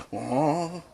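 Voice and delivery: ordinary talk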